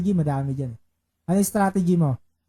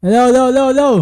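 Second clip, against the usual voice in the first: loud mic check; 5 semitones higher, 8 dB louder than usual. Real, not acted. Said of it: "Mahina boses natin".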